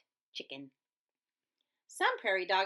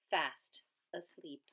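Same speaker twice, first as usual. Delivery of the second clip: soft whisper; 4 semitones lower, 7 dB quieter than usual. A narrator's reading. Narrator lulling the dogs to sleep, whispered. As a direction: hush it like sleeping pups. Prairie dogs fall asleep.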